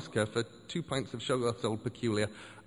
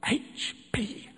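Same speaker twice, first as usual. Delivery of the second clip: loud hiss; 7 semitones higher, 3 dB louder, much slower than usual. Acted. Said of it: "he hissed very loudly".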